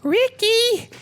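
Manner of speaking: put-on very high, very slow squeaky girlfriend voice; high, drawn-out mock girlfriend; weird accent. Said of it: "She's got a weird accent".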